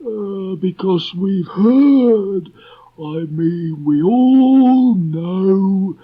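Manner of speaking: very high, slow and hesitant donkey voice; gloomy donkey stammering through a speech; Eeyore voice. Acted. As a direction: Eeyore stumbling over a speech to Christopher Robin.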